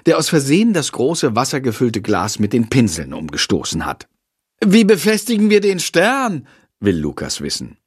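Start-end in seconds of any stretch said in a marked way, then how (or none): 4.6-6.5 voiced pupil. Pupil asks teacher.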